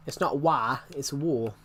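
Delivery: usual speaking voice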